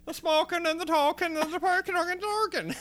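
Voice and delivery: high, loud hammed-up Irish accent; a jokey over-the-top Irish voice; mock Irish accent. Podcaster mocks the show's Irish accents in a hammed-up Irish voice. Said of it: "shitty Irish accent".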